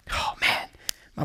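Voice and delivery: hushed breathy aside; whisper. Real, not acted. Preacher introducing his favourite hero, awed whisper. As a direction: drop to a whisper in awe. Aside before David story.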